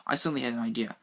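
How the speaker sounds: ordinary delivery, nothing marked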